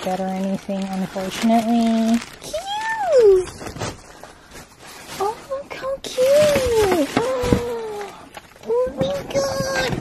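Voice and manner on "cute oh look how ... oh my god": very high, excited squeal; cute squeal. Real, not acted. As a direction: squeal over something adorable. Gushing over cute gifts.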